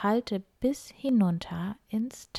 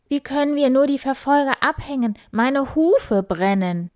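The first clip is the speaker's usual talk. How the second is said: breathless boar, higher and louder; panting; 7 semitones higher, 7 dB louder than usual. Acted. Exhausted boar Wildi pants to the fleeing group. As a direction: gasp it out after running. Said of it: "Wildi keuchte".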